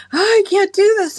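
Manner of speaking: high, loud whiny mock panic. Woman mimicking panicked people, high mock wail. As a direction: imitate people freaking out over lockdown.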